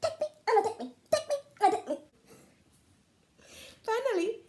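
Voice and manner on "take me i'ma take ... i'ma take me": shrill pot chorus. Acted. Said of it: "she heard".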